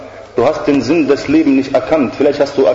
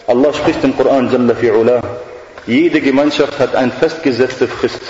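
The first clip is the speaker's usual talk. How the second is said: ordinary talk, nothing marked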